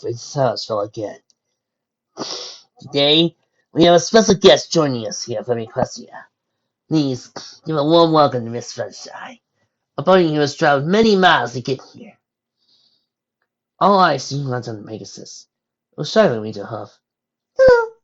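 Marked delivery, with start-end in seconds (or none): 2.8-17.0 loud, grand hostess speech; formal announcement. Acted. Freya formal speech to guests. 17.6-17.9 very high tiny squeak. Acted. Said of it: "she squeaked".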